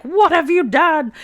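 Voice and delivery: high, loud, drawn-out cry; outraged shout, imitated. Professor Carr confronts the riders, imitated outraged shout. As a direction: act out a horrified accusation, slowly.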